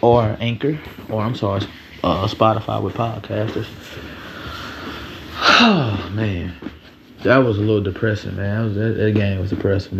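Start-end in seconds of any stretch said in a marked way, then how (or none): 3.7-5.6 long, breathy exhale; heavy sigh. Real, not acted. Podcaster recalling a depressing game, heavy sigh. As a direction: let out a long weary sigh.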